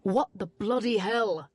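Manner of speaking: lightly voiced English accent, slow; a hint of an English accent, startled. A narrator's reading. English stranger startled by the charging woman, English accent. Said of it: "he asked in a clear English accent".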